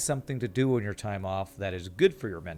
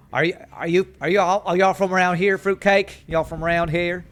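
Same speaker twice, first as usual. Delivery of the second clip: loud, high mock Texan; imitating a Texas accent; 7 semitones higher, 7 dB louder than usual. Streamer imitating a viewer's accent, loud mock Texan. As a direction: tease with a big Texan twang. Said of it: "flattering your wonderful Texas accent".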